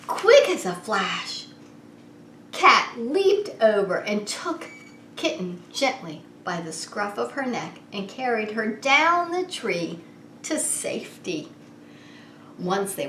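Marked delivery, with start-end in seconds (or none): none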